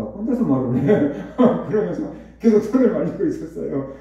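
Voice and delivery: laughing through the words. Real, not acted. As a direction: chuckle while telling it. Laughs at the memory. (웃으면서 말하듯)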